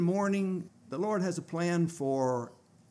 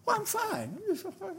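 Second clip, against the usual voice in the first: much higher, light woman's voice; imitating the woman; 4 dB quieter than usual. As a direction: play her brushing it off in a high voice. Revived woman reassures the others.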